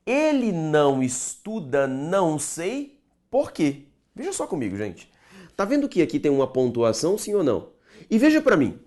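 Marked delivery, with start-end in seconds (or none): 0.0-3.9 slow model sentence. Shown as a demonstration. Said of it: "Olha pra mim a última".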